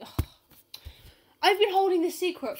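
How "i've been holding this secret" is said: pitched-up mock confession; joking confession to a friend, pitched up. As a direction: confess it like a big secret. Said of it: "mock secret confession".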